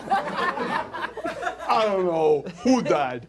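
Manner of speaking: very slow, woozy mourner; dizzy eulogist. Acted. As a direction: drawl it out like a dazed eulogist. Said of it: "dizzy eulogist".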